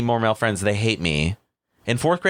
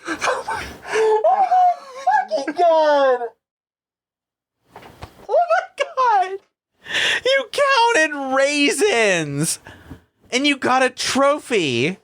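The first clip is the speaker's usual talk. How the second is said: high, slow, laughing through tears; crying laughing; much slower than usual. Real, not acted. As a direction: gasp the words out between laughs.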